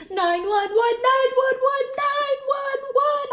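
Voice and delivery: high, loud panicked hollering; a little girl hollering for help. A narrator's reading. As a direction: holler for help at the top of your lungs. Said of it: "I hollered".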